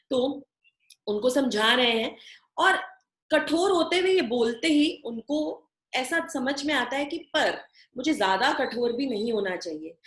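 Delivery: ordinary talk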